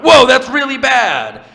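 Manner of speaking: very high, loud, drawn-out shock; hammed-up cry of horror; appalled second doctor. Second doctor seeing the patient, high hammed-up horror.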